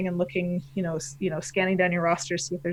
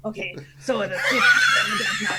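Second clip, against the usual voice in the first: higher, reworked pirate delivery; recalibrated pirate voice; 4 semitones higher, faster than usual. Acted. Redoing the team names, recalibrated Canadian pirate voice. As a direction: restart it as a more theatrical pirate. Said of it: "recalibrate your voice".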